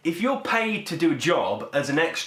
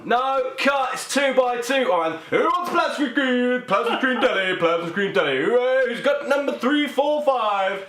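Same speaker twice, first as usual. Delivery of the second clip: loud, high-pitched bingo caller; Russell Crowe; 10 semitones higher, 3 dB louder than usual. Acted. Joker playing a film star calling bingo numbers, rowdy caller shout. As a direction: call it out like a hammed-up bingo host. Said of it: "Russell Crowe, the bingo caller".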